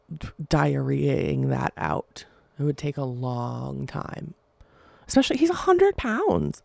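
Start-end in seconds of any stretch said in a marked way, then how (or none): 5.1-6.6 excited squeal. Real, not acted. Outburst over gross detail.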